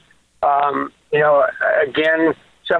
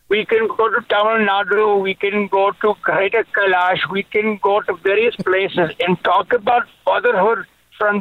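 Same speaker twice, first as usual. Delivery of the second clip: lightly put-on Indian accent, higher; hammed-up Indian accent, higher pitch; 5 semitones higher than usual. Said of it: "from Delhi".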